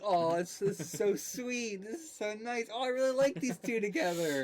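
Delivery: high, cooing delight; gushing. Retold or imitated. Viewer recalling a reaction to the couple, gushing high.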